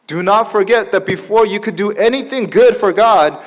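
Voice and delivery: loud, high, insistent preacher voice; full-force preaching. Real, not acted. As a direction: hammer the point home to the whole congregation. (높고 큰 목소리로 열정적으로 설교)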